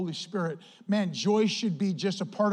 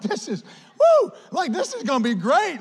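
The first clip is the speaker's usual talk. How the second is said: excited; 7 semitones higher, 5 dB louder than usual. Retold or imitated. Excited retelling of his reaction.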